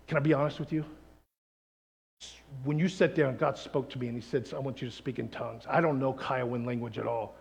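low, soft, tender sincerity; sincere confession. Acted. Preacher to Kiowa seatmate, sincere and hushed.

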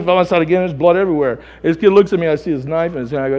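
ordinary talk, nothing marked

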